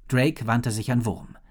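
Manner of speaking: ordinary delivery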